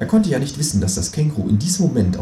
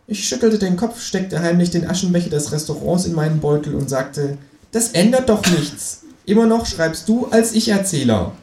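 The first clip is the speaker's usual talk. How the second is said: high Kangaroo voice telling it; pitched-up Kangaroo taking over the story; 8 semitones higher than usual. Acted. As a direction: narrate it as the smug Kangaroo. Känguru now narrates.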